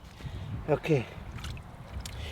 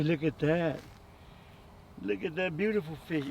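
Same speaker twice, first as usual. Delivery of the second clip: usual speaking voice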